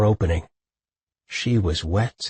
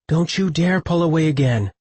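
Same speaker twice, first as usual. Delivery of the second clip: woman's voice; 8 semitones higher than usual. A narrator's reading. Woman demands he stay.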